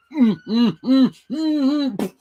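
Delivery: high, loud muffled mm sounds; mocking muffled hum. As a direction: hum through a closed mouth. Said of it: "And she goes".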